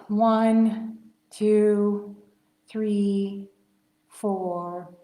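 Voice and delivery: plain reading voice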